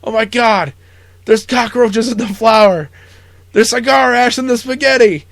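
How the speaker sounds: high, loud disgusted tirade; hammed-up officer screaming at the cook; angry yelling. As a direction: rage at the filthy kitchen. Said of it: "yelling at Buckman".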